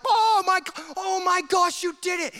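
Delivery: overjoyed angelic crowd voice; ecstatic angels. Acted. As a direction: cheer like angels losing their minds. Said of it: "the angels freaking out, like".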